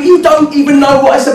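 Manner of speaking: high-pitched retort at normal pace; exasperated comeback. Retold or imitated. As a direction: fire the protest straight back. Argument loops, retort repeated.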